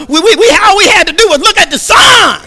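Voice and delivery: high shouted preaching peak; a sudden full-voiced preaching cry; preacher's shout. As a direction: belt it out to the congregation.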